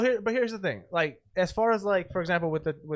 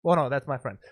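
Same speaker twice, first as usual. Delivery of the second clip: ordinary talk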